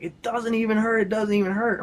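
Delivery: high, mildly tearful amazement; retold bawling. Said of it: "and he's just bawling".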